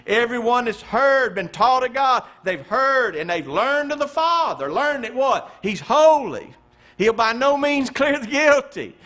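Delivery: high, loud climbing sermon cadence; impassioned pulpit build; preaching at full force. Real, not acted.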